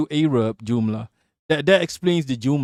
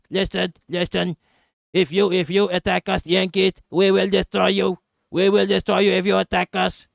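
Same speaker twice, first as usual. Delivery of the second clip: higher, level-pitched mock Arabic accent, no rise or fall; flat one-tone threat; 5 semitones higher than usual. Streamer mocking a warning to Americans in a higher monotone Arabic accent. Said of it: "It's just one tone".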